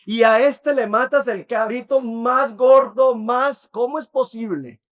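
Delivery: bitter, wounded resentment poured out at full volume; angry older son. Retold or imitated. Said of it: "él se enoja y le dice".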